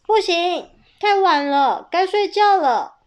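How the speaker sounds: 高声又大声的严厉妈妈; 提高嗓门命令孩子去睡觉; 妈妈严厉喊话. Acted. 妈妈叫亚瑟去睡觉. (大声严厉地催睡觉)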